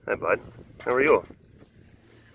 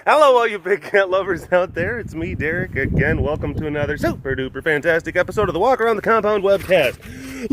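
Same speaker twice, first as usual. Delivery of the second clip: high, upbeat announcer patter; webcast host intro; 6 semitones higher than usual. Acted. Host welcoming big cat lovers, upbeat intro. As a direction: greet the audience like a show host.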